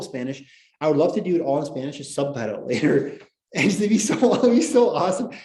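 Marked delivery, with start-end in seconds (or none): 3.5-5.3 high, loud, giggling through it; laughing excitedly. Real, not acted. Teacher picturing the Spanish class, laughing excitedly. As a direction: laugh with delight at the idea.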